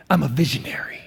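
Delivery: soft, whispered mock-earnest announcement; hushed visionary voice. Young man announcing his calling to his pastor, hushed and mock-earnest. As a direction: hush it like a precious secret. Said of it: "you gotta say it like this".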